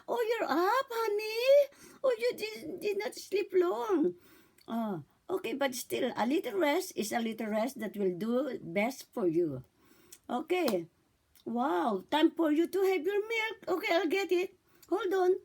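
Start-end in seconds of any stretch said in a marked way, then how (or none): 0.1-4.1 much higher, sing-song cooing; high baby talk. Shown as a demonstration. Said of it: "pag nagising na siya". 11.5-15.3 higher, bright cooing voice; high baby talk. Shown as a demonstration. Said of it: "Narinig po lahat yan".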